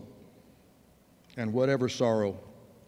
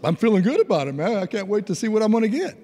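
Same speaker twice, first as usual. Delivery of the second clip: louder, higher, boyish anticipation re-enacted; eager excitement; 6 semitones higher, 4 dB louder, faster than usual. Grandson eager for his Christmas presents, mock excitement. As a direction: burst out higher, full of eagerness.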